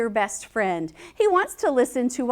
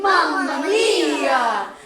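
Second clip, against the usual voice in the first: mock Italian exclamation; 5 dB louder, much slower than usual. Playful exclamation.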